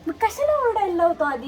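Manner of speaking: protesting loudly. Real, not acted. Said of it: "ओरडत जाऊ नको".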